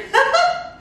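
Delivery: bright burst of laughter; high laugh. Real, not acted. Laughs after joke.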